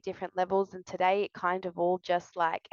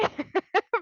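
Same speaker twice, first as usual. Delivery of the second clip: amused laugh; 12 semitones higher than usual. Real, not acted. Laughs at her own worry.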